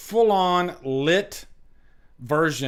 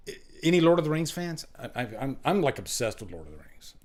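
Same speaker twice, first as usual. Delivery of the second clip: usual speaking voice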